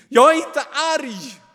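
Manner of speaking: very high, loud shriek; angry scream. A narrator's reading. Kain screams his denial at the angel. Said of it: "nästan till som ett skrik".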